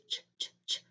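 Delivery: ch, ch, ch as a soft unvoiced ch; ch said alone as a sound. Shown as a demonstration. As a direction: hiss out the ch sound. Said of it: "there's the C H".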